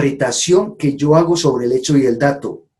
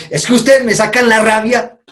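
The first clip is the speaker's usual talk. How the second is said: high, accusing outburst; blaming anger; 8 semitones higher, 3 dB louder than usual. Shown as a demonstration. Speaker repeats the blaming example to the audience, accusing tone. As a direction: point the finger at them. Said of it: "cuando les digo".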